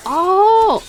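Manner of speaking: loud, high-pitched "oh" of discovery; suddenly it clicks, real surprise; surprised realization. Real, not acted. Sudden realization about the voice actor.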